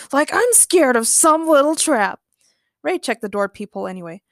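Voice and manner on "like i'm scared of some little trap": loud, high mock bravado; scoffing. A narrator's reading. Ray dismisses the warning of a trap, scoffing. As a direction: scoff at the idea with swagger. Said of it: "Ray laughed".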